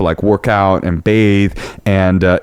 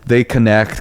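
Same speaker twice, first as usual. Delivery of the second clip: ordinary talk, nothing marked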